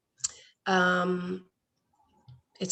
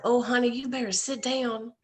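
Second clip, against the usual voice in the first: put-on Southern accent; 4 semitones higher than usual. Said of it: "in this real Southern accent".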